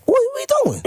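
high, laughing disbelief; shocked disbelief. Retold or imitated. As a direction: squeak it out in amazed shock. Shocked rookie at first practice.

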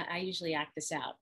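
ordinary talk, nothing marked

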